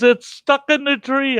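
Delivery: put-on high small boy answering; little kid voice. Grandson telling grandpa where the toy is, high kid voice. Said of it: "He goes".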